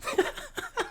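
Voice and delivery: high, breathy burst of laughter; real laugh. Real, not acted. Speaker amused that words count so little, laughing. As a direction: crack up at the absurdity. Amused by the seven percent figure.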